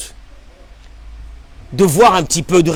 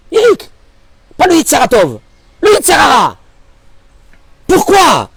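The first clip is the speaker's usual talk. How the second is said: emphatic shout; 8 semitones higher, 4 dB louder than usual. Real, not acted. Preacher stresses the term.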